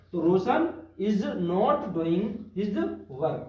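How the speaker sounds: very slow, word by word dictation; slow, even classroom dictation. Shown as a demonstration. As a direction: give the class time to write each word. Teacher dictates the full translation.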